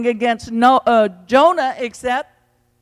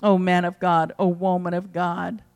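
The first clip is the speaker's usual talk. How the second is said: normal talking voice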